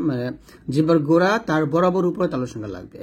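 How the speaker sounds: usual speaking voice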